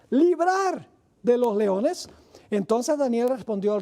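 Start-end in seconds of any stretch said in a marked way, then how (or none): 0.1-2.1 librar said slowly and louder; slow, emphatic key word librar; stress on librar. Shown as a demonstration. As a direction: land hard on librar, drawn out. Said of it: "aquí está la palabra clave".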